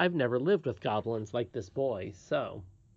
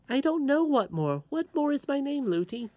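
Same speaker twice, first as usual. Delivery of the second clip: put-on high-pitched, innocent child; puzzled little princess; 14 semitones higher, 4 dB louder than usual. Princess asking nurse her full name, puzzled. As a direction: ask it as a puzzled little girl.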